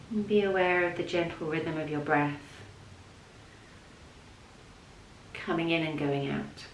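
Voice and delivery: soft, level voice with long pauses; unhurried, hushed yoga nidra guidance; guided meditation. Real, not acted. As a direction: guide them gently down into rest.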